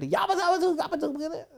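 high squeaky [name] yabba dabba doo; [name] impression. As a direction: trill it high like a pop diva. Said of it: "and she said".

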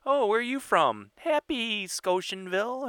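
high, slow put-on movie voice; movie character impression. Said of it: "Or Mister Deeds?".

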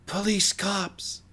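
high, drawn-out yell kept quiet; a held-back, high-pitched cry while falling; mock dying yell. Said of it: "he just yells".

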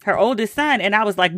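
ordinary talk

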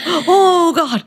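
mock excitement. Remembering an email mid-sex, high mock excitement. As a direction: squeal it like a sudden idea.

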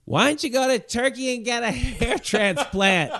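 high-pitched mock drunk heckler; hammed-up high holler from the corner; drunk heckler yell. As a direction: imitate a drunk girl yelling out. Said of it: "girl in the corner who's drunk yells out".

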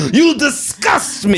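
high, slow disgusted shout; angry Italian shopkeeper. Retold or imitated. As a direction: scorn him like an offended owner. Angry shop owner imitated.

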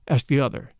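ordinary delivery

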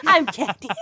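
ordinary talk, nothing marked

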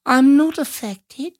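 high, slow prissy voice; prim mock dignity. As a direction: act prim and unaffected. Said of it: "he's trying to pretend like".

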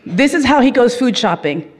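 usual speaking voice

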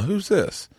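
high Southern mother-in-law asking; Southern accent. Retold or imitated. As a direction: imitate a Southern mama, pitched well up. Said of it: "it was a really Southern accent".

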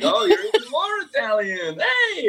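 squealed high and excited; hammed-up delighted announcement; mock cheerful update. The ancestry app cheering him on, in a mock high squeal. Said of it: "It's like".

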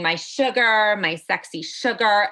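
usual speaking voice

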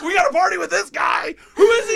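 high, loud gleeful gangster yell; excited shout. Retold or imitated. Gangsters cheering the American guest, excited shout. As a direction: whoop it up like drunk partiers. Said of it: "They're like".

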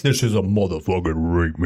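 low, deep hype-man announce; deep mock DJ voice; hype announcer. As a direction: drop it like a DJ intro. Announcing a joke remix.

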